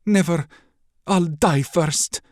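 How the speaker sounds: high, loud, slow defiant princess; defiant refusal. Acted. Princess refuses the prince's demand, high and defiant. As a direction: spit the refusal back at him.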